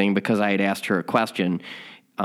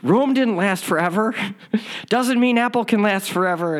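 agitated quip; 11 semitones higher than usual. Retold or imitated. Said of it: "what I said to her is".

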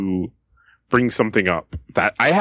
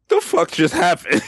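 higher, mock-stunned disbelief; baffled reaction; 5 semitones higher than usual. Man reliving a stranger's bad joke, baffled. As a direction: replay being utterly thrown.

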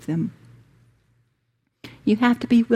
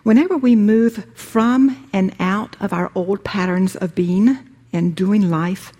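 normal talking voice

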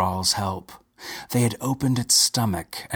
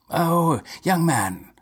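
calling out, voice raised high; 8 semitones higher, slower than usual. A narrator's reading. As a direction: hail the young man from a distance. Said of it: "shouted Geral".

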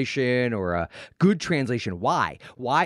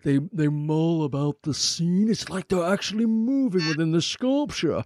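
pretentious art lover; gushing art snob; 2 semitones higher than usual. Retold or imitated. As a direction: swoon over the sculpture like a wine-fest snob. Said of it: "they would all go".

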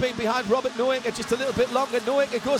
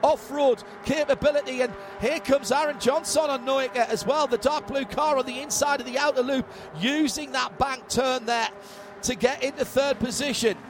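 raised, lively live race call; motorsport commentator; 4 semitones higher than usual. Acted. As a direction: call the overtakes as they happen.

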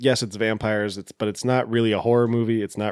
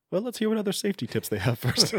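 a much higher, lightly voiced Vladislav; 9 semitones higher, 4 dB quieter than usual. Host quoting the vampire's line to his co-host, high mock voice. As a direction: pitch it up as the eager vampire. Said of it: "And Vladislav is like".

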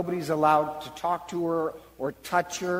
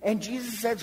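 normal talking voice

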